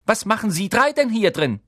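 high, loud commanding demand; stern authority ringing through a hall; imperious. A narrator's reading. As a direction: call out sharply with authority. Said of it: "eine gebieterische Stimme".

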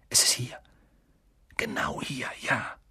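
hushed, breathy discovery; soft voiceless whisper; excited whisper. Acted. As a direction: whisper that you have found it. Secret discovery at night.

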